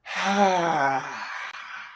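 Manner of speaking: breathy high sigh out on the exhale. Shown as a demonstration. Teacher demonstrating to the class a high breathy exhale. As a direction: let the breath out high and open. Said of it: "Exhale on high".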